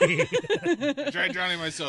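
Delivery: mock tragic cat. Joking as the immortal cat, hammed-up tragic. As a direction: moan it like a cursed cat.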